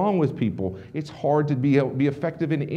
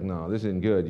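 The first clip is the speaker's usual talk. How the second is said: glum buddy; 7 semitones lower, 3 dB quieter than usual. Retold or imitated. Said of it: "He's just not getting into it".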